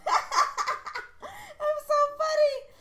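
high, slow, giggling; laughing at herself. Real, not acted. After her rapper line, laughing. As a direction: giggle at your own joke.

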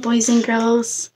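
ordinary delivery, nothing marked

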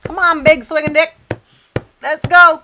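loud, high hype call. Real, not acted. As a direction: pump the dog up loudly. Loud hyping of dog.

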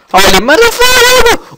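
a high, very loud startled shriek; shocked scream. Real, not acted. Gamer jumps at a mob, screaming in shock. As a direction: scream it out in sudden fright.

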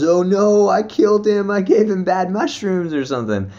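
high, loud imitation of a panicking friend; mock panic. Friend thinks he has poisoned him, mock panic.

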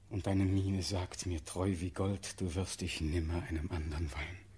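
very low, subdued and grieving; sorrowful tenderness. Acted. Doomed prince consoling the weeping girl who loves him, sorrowful tenderness. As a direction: console her softly from deep resignation.

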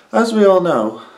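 emphatic. Acted. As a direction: belt it out with emphasis. Second take, bigger delivery.

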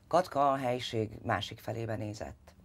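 plain reading voice

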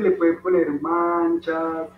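put-on slow and deliberate for the kids; slow teacher's emphasis on le pueden poner manchas; slow, spelled out. Shown as a demonstration. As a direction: lay each word down slowly so children follow. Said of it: "Ya es súper fácil".

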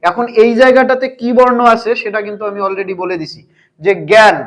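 ordinary talk

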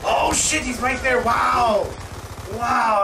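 loud, high yelp of surprise; startled outburst. Real, not acted. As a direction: blurt it out, caught off guard. Startled by enemy in game.

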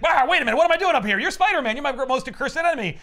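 high, loud hammed-up alarm; panicked Lizard villain. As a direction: play a flustered supervillain waking up mid-air. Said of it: "He's like".

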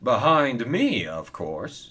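drawn-out snootiness, tossed off at the end; snooty. Acted. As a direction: put him in second place with haughty self-importance. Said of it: "said Spencer snootily".

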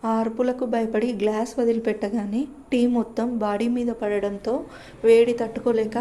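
ordinary delivery, nothing marked

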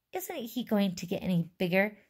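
plain reading voice

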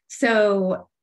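ordinary delivery

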